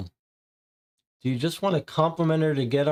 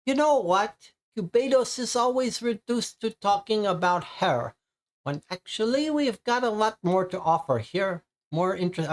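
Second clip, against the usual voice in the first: higher, hammed-up German accent for the dance teacher; 9 semitones higher than usual. Dance teacher Bonita gossiping about her rival, in a German accent. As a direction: play Bonita with a thick German accent, pitched up. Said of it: "I don't know why she's speaking in a German accent".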